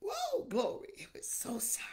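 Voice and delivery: breathy whisper of repentance; whispered prayer. Real, not acted. Pastor whispering sorrow to God, hushed.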